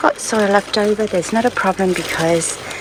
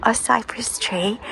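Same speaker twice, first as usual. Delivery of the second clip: whispering; 3 semitones higher, 3 dB quieter than usual. Real, not acted. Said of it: "Sorry, I'm whispering again".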